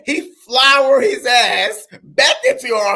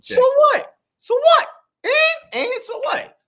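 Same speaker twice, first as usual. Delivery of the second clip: defiant so what. Real, not acted. Defiant retort to criticism.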